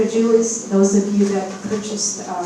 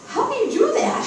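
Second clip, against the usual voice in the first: much higher, disbelieving daughter voice; imitating daughter. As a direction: squeak it out like a puzzled daughter. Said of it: "She says".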